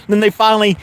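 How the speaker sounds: high, amused, through giggles; laughing while telling. Real, not acted. Laughing through funny deer story.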